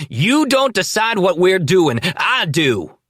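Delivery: high, loud domineering yell; furious shout. A narrator's reading. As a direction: bark your authority. Danny asserting authority.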